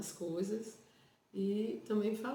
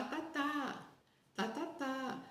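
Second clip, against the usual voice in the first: high playful babbled syllables; balbucio; 8 semitones higher, much slower than usual. Shown as a demonstration. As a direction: babble back to a baby, pitched high. Demonstra balbucio com o bebê. (balbucio agudo imitando bebê)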